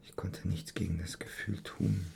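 very soft, whispery murmur; murmured echo. Acted. Man echoing a confession of helpless feeling, murmured to himself. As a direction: repeat it to yourself, barely voiced. Repeats her words to himself.

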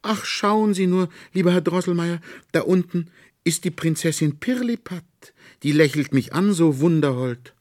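put-on high, loud delighted girl's voice; girl calling out with delight; joyful cry. A narrator's reading. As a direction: cry out in wonder like a little girl. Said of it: "rief sie freudig".

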